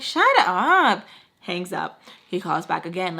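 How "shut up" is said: loud, high retold outburst; mock yell to cut him off; angry yell. Friend cutting off father's cussing on speaker, angry yell.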